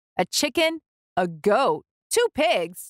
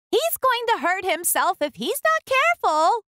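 worried sheep; 12 semitones higher than usual. A narrator's reading. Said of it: "what she thought was".

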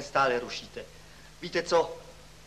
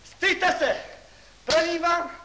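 higher, louder, building outrage; furious yelling; 12 semitones higher, 6 dB louder than usual. Acted. Professor to class after the prank, furious higher louder yelling. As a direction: shout until the voice cracks.

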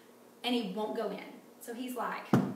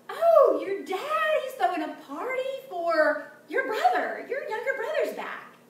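excited servant; 10 semitones higher, 6 dB louder than usual. Acted. Servant answers the older brother.

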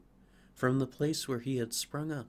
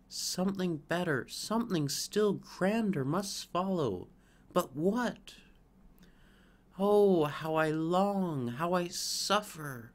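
higher, louder, aching longing; yearning fir; 6 semitones higher, 3 dB louder than usual. Acted. Fir tormented by wanting something grander. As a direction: plead with the world in longing.

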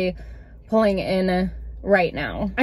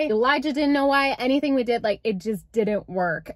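ordinary talk, nothing marked

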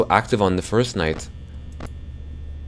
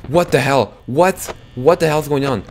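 startled outburst; 6 semitones higher, 4 dB louder than usual. Real, not acted. Sudden camera blackout scare.

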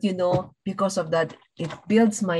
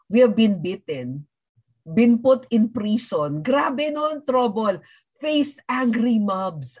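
quoting Paul; 4 semitones higher, slower than usual. Acted. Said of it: "Parang sabi niya".

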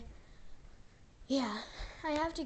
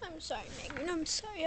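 ordinary delivery

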